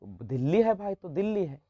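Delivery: put-on soft, gentle explanation to a child; lovingly. Shown as a demonstration. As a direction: reassure the pupil kindly. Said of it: "उसको प्यार से ना बताइएगा". (बच्चे को प्यार से, नरम आवाज़ में)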